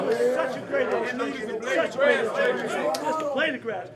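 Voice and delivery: loud, emphatic team speech; fired up. Real, not acted. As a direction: pump up the players.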